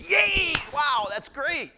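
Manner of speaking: preacher mocking the joy Jonah should show; mock cheer. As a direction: cheer like a delighted kid. Preacher voices expected happy Jonah.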